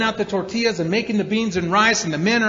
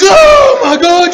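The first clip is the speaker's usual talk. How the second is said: slow, loud shocked cry, higher; shocked crowd; 12 semitones higher, 15 dB louder, slower than usual. Retold or imitated. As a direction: gasp it out as the whole party. Crowd reacts to stabbing story.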